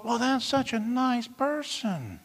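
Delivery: soft, slow, gushing imitation; hushed, drawn-out mock admiration; naive admirer. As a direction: coo it slowly, mocking naive approval. Mimicking naive praise of someone.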